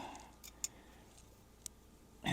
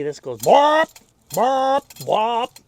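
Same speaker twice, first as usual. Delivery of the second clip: bop sounds; 11 semitones higher, 13 dB louder, much slower than usual. Retold or imitated. Imitating the engine sound.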